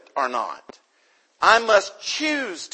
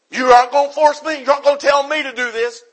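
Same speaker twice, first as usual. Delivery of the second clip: defiant rebel; 9 semitones higher, 7 dB louder, faster than usual. Retold or imitated. Mimicking defiant Americans.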